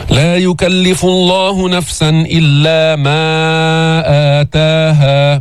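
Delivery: slow chant with a long "maa" before آتاها; separated madd. Shown as a demonstration. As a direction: stretch ما, then lengthen the start of آتاها. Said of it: "مد منفصل بعدين مد بدل".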